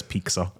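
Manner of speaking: "pizza" said as "peek-sa"; Jose's mispronunciation. Retold or imitated. Telling cohosts how Jose says it, mispronounced. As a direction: put a K in "pizza". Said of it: "Jose says pizza".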